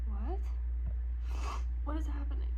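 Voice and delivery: loud, stunned disbelief; shocked. Real, not acted. Reader shocked by a twist in the book, loud disbelief. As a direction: demand it in disbelief.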